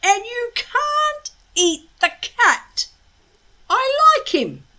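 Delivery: losing his calm; exasperated boy. A narrator's reading. Hiccup protecting the cat from the dragon, exasperated.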